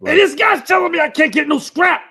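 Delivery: loud, high outraged protest; indignant shout. Acted. Player complaining loudly to the table about the NPC. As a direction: yell it out in disbelief.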